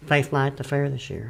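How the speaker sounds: trailing off quietly; mumbled. Real, not acted. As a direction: say it under your breath, humbly. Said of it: "You kinda mumbled that".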